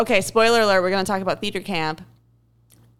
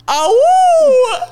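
high, loud laughing howl; wolf howl; 11 dB louder than usual. Retold or imitated. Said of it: "I howled".

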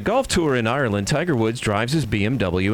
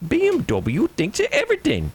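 much higher Irish voice, full of wonder; high Irish awe. Acted. Pump attendant marvelling at BMW, high Irish awe. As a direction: marvel at the car like a yokel.

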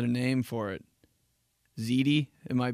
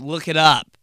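loud, curt mom; annoyed mom brushing off her kid's spelling question; mom snapping; 4 semitones higher, 12 dB louder than usual. Retold or imitated. Mom dismissing the kid.

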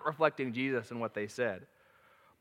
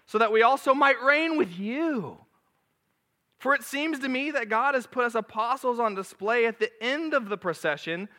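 mocking, much higher and louder, drawn out; sarcastic Paul; 8 semitones higher, 6 dB louder than usual. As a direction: sneer at them as Paul, dripping sarcasm. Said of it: "This is all sarcasm".

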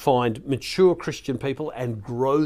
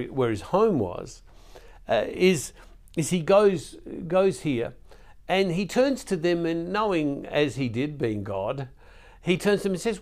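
ordinary delivery

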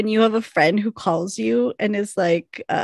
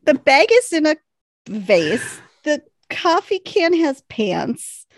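slow, louder incredulity; amused disbelief; 6 semitones higher, 4 dB louder, slower than usual. Real, not acted. Cohost mocking the coffee can, slow amused disbelief. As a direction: lay out the absurd fact slowly. Deadpan absurdity.

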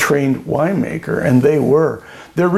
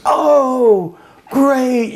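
high, bright mock-gushing woman; gushing seatmate; 10 semitones higher than usual. Seatmate on the plane gushing at the winemaker, high mock enthusiasm. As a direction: play the chatty seatmate, lightly imitated.

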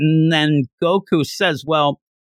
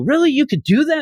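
surprised Goku; 13 semitones higher, faster than usual. Retold or imitated. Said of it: "you even have Goku".

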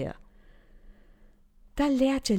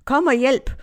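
raised, urgent call to a neighbour; retold call for help; 4 semitones higher, 5 dB louder than usual. Woman calls to Ibrahim as a man pushes in, retold urgent shout. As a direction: call out for help, louder and higher. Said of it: "råber jeg".

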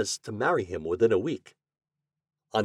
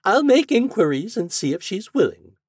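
higher, louder maid's voice; prim lady's maid; 11 semitones higher, 8 dB louder than usual. Acted. Maid replies to the glassblower, high and prim. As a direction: answer politely but briskly.

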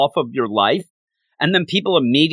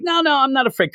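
high-pitched mock protest; defensive denial; 13 semitones higher, faster than usual. Thor denying his fear to Jane, mock defensive. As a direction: protest too much, voice shooting up.

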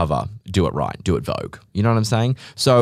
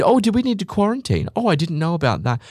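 much higher, airheaded innocent mimicry; mock clueless traveller; 11 semitones higher than usual. Host mimics travellers who dodged quarantine, ditzy and high. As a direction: play dumb in a ditzy high voice.

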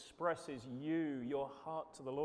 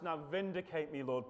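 ordinary talk, nothing marked

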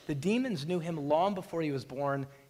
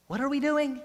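higher-pitched, bewildered question; alarmed disciples; 7 semitones higher than usual. Acted. Voicing alarmed disciples in the boat.

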